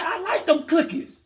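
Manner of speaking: put-on high, slow baby quote; voicing the baby. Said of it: "And you said".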